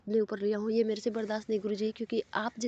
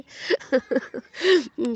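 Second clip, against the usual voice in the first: a longer real laugh, louder, falling away; embarrassed laugh; 4 semitones higher, 8 dB louder than usual. Real, not acted. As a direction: laugh it off, a bit shy. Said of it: "मुझे हँसी भी आ रहा है". (झेंपती हुई हँसी)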